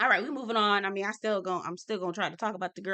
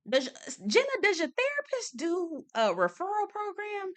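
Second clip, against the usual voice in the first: mock innocent ask; 10 semitones higher than usual. Mock question to Jenna.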